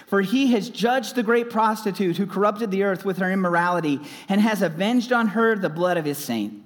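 usual speaking voice

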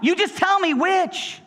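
high, insistent pleading; acted frustrated begging; exasperated demand. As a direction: push for an answer, voice pitched right up. Praying person demands an answer.

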